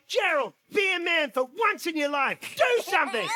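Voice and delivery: hammed-up outraged wife; Mama Bear hitting the roof. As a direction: berate a useless husband. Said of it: "Mama Bear hits the roof".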